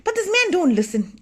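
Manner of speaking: ordinary delivery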